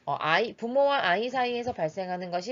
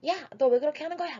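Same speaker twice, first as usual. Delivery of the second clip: quick, higher-pitched acted telling-off; mock angry scolding; 8 semitones higher, much faster than usual. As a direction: play an annoyed parent, fast and high without shouting. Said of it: "막 화를 내거나 하는 것이 아니라".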